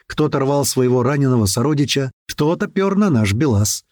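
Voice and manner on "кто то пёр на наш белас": usual speaking voice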